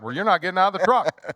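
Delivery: high, fast mock threat; quick high put-on dad ultimatum; firm dad. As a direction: lay down the law, half joking. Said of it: "I'm like".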